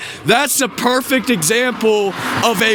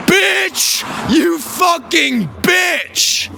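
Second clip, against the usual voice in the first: furious yell; 7 semitones higher, much slower than usual. Retold or imitated. Ranting at the lady in the story, furious yell.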